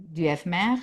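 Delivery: normal talking voice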